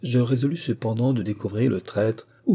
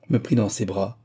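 ordinary delivery, nothing marked

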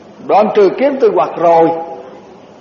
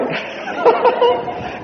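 high burst of laughter, softer; amused laugh; 4 dB quieter than usual. Real, not acted. Monk laughs recalling his mother's curse, high amused laugh. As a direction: laugh out at the memory.

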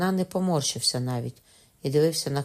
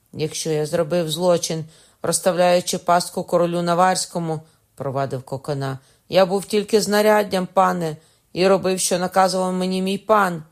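loud, higher excuse; loud pleading confession; 3 semitones higher, 7 dB louder than usual. A narrator's reading. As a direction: plead your case at full voice. Prisoner loudly confessing to judge.

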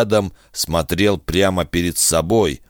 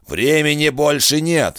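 angry shout; 9 semitones higher than usual. Acted. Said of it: "сердито крикнул Кусака".

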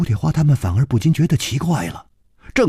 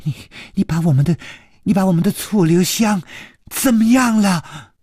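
trembling voice; 7 semitones higher, faster than usual. Acted. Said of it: "颤声说".

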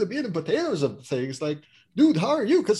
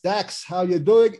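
normal talking voice

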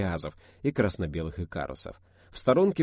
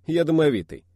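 playful protest; 9 semitones higher, 9 dB louder than usual. Acted.

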